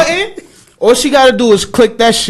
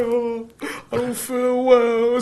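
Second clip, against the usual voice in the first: whiny sick voice; 8 dB quieter, slower than usual. Retold or imitated. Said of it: "She wanna start crying now".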